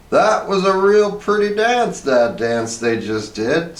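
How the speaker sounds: high, slow, dim-witted wife voice; Hazel's voice. A narrator's reading. Wife gushing over the ballet on TV, high and slow. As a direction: draw the words out high and simple. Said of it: "said Hazel".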